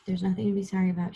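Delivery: ordinary delivery, nothing marked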